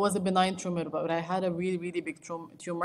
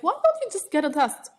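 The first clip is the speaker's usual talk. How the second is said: high, louder exasperated suggestion; impatient educator; 10 semitones higher, 6 dB louder than usual. Retold or imitated. Diabetes educator urging the patient to just test, pitched up. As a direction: play the educator pushing her to test. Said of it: "She's like".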